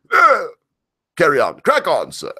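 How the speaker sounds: high mock British dismissal; brisk hammed-up Brit waving him on; British accent. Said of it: "I can hear the British right now".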